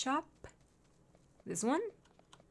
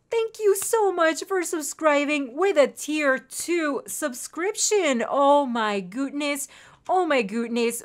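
slow, drawn-out, higher-pitched gushing; gushing thanks; 4 semitones higher, 4 dB louder, slower than usual. Real, not acted. Streamer thanking a tier two subscriber, gushing. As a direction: stretch out every word with delighted gratitude.

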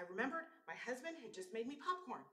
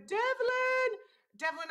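calling out; 14 semitones higher, 13 dB louder, slower than usual. Real, not acted. Calling out a viewer's name.

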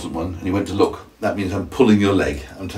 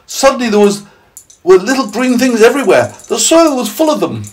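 high, loud character voice; excited telling; 6 dB louder than usual. Acted. Miss Spider adding to the tale, high and excited. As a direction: tell it high with amazed energy.

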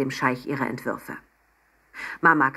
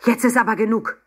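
loud, higher-pitched scolding; angry outburst; 6 semitones higher, 6 dB louder than usual. A narrator's reading. Fed-up Papa scolds at the table, angry outburst. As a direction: put your foot down crossly. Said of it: "rief Papa zornig".

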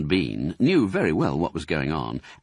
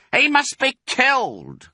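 much higher, slow and louder; cried out; 5 dB louder than usual. Acted. Bean demanding the fox's death, high sharp cry. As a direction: spit out the death sentence. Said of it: "cried Bean".

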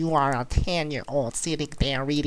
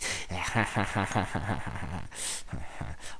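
long, low run of soft laughter; breathy chuckle; 5 semitones lower, 8 dB quieter than usual. Real, not acted. Gamer laughing at his faked-out opponent, long soft low chuckle. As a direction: snicker softly and keep it rolling.

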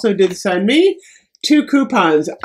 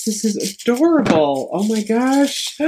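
usual speaking voice

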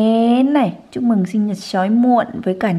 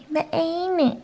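a higher, softer praising repeat; praising echo; 7 semitones higher, 7 dB quieter than usual. Shown as a demonstration. Mother praising her baby for saying papa, higher and soft. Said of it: "Đúng rồi".

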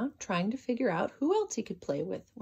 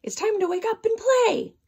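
much higher, much louder shout; 12 semitones higher, 8 dB louder than usual. Acted. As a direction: call up into the tree at full voice. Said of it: "he shouted".

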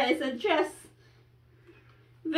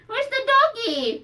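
put-on reading to a toddler; 9 semitones higher than usual. Parent quizzing toddler with cards.

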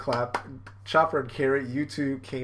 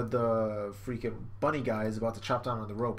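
usual speaking voice

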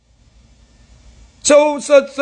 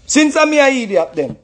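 ordinary talk, nothing marked

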